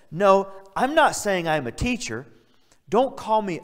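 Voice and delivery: high, loud emphatic protest; emphatic quote. Acted. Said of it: "he's a little melodramatic".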